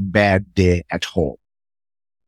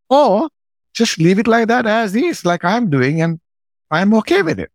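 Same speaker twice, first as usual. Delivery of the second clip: jumps way higher, light and unbothered, voicing himself to the wife; breezy high mock-casual; 11 semitones higher than usual. Telling his wife he'll just leave the mess, breezy and much higher. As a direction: shrug it off, sing-song carefree.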